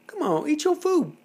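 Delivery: put-on high coaxing baby talk. Dad coaxing baby to eat.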